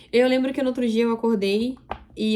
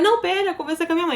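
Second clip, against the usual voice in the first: high, urgent plea; begging him to wait, voice up high; retelling her desperate plea on the phone; pleading; 7 semitones higher, faster than usual. Retold or imitated. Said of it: "Eu falei".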